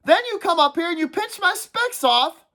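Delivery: put-on aggrieved kid complaining; Piggy's voice.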